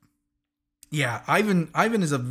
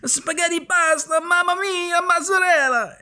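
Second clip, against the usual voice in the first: much higher hammed-up Italian accent; mock Italian; 13 semitones higher than usual. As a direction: ham up a cartoon Italian accent. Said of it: "the most Italian name on the planet".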